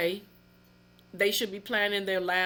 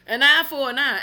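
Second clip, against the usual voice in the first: angry declaration; 3 semitones higher, 8 dB louder than usual. Real, not acted. Furious rant about child abuser.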